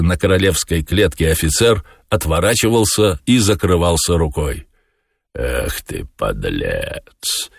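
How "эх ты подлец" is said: very low, soft musing; pensive. A narrator's reading. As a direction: muse the insult quietly, deep down. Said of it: "задумчиво сказал Воланд". (задумчиво, низко и тихо)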